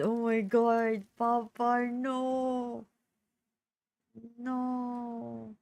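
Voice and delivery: hammed-up tearful begging; dramatic plea. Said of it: "trying to hold my tears".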